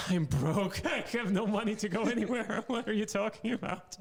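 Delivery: high, loud, laughing protest; mock exasperation. Comic answering where he studied abroad, mock-exasperated and laughing. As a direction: protest as if the question were absurd. Acted retort to absurd question.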